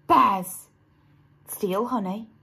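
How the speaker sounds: ordinary delivery, nothing marked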